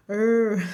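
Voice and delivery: the bare R as a held retroflex "errr"; caipira R. Shown as a demonstration. Teacher isolating the sound of R, caipira R held long. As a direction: hold "R" as a curled-tongue rural Brazilian sound. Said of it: "vai fazer o som caipira".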